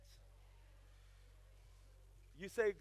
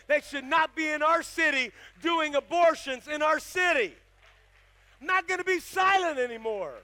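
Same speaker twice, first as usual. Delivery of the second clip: building to a loud, higher shout; rally the city, louder with every line; preaching at full force; 6 semitones higher, 12 dB louder than usual. Real, not acted. Preaching crescendo.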